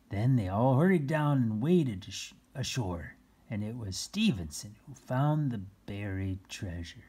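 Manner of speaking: ordinary delivery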